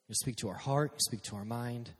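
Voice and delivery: normal talking voice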